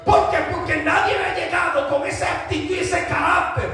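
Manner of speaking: high-pitched, forceful sermon delivery; impassioned preaching. Real, not acted. Preacher telling church of the woman's answer to Jesus, impassioned preaching. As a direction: preach it out to a responding congregation.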